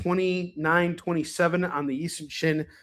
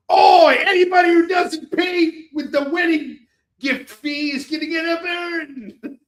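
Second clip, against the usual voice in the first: hammed-up Australian bouncer yelling, much higher and louder; mock Australian accent; 12 semitones higher, 7 dB louder than usual. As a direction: shout it like a rowdy Australian security guy, laughing through the end. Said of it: "he talks with a fucking Australian accent".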